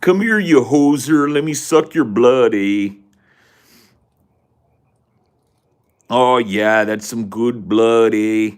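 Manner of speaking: a Canadian vampire gleefully calling a victim over, loud. Acted. A Canadian vampire luring a victim, hammed-up and loud. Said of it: "A Canadian vampire?".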